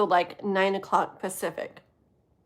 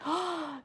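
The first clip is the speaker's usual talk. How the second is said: imitated gasp; 8 semitones higher, 7 dB quieter than usual. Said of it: "He's like".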